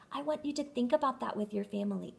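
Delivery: plain reading voice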